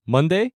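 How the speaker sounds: Monday rising at the end like a question; rising question intonation. Shown as a demonstration. Teacher translating a guess at the day, Monday with rising intonation. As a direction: ask Monday as a one-word question. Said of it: "there's that rising intonation".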